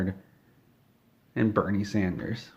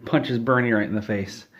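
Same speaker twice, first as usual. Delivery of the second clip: usual speaking voice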